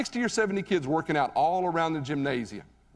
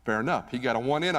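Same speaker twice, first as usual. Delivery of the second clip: ordinary talk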